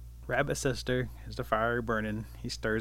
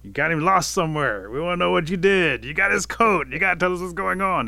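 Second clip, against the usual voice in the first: loud accusation, building higher; stern confrontation; 5 semitones higher, 6 dB louder than usual. A narrator's reading. Bear grills captured Rabbit about Otter, loudly accusing. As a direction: press them hard, louder with each charge.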